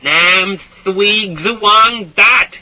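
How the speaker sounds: slow, high, loud bellow. A narrator's reading. Sweating soldier bellowing at villagers, slow loud. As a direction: bellow it word by word. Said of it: "he'd bellow".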